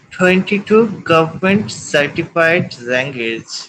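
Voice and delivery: slow and measured, word by word; slow, careful repeat. Real, not acted. Student repeating for the tutor who missed it, slow and careful. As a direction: spell it out slowly for the listener.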